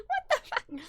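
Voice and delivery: squeaky laughing what the; laughing disbelief. Real, not acted. Retelling the meeting, laughing high in disbelief.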